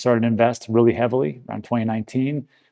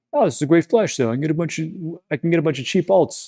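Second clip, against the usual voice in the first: lightly imitated YouTuber, pitched a little higher; mock YouTuber; 4 semitones higher than usual. YouTubers pitching cheap alts to viewers, lightly mocked higher voice. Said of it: "these YouTubers who were like".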